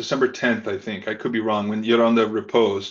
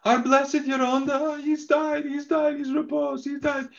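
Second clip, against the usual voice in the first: much higher, crying wail of grief; retold sobbing lament, pitched way up; imitated sobbing woman. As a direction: wail it out high like a woman in tears. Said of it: "she's sobbing".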